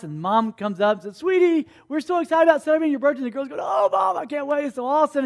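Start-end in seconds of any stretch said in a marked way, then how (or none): 1.2-3.2 higher, quick gushing mom; rushed, high-pitched excitement; excited mom. Acted. Mom excited about birthday in story. 3.6-5.2 high, gushing daughter voice; hammed-up girlish excitement; thrilled girl. Girl thrilled about her birthday.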